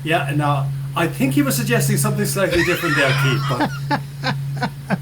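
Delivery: talking through laughter; laughing. Real, not acted. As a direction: say it while still laughing. Amused by the host's bank joke.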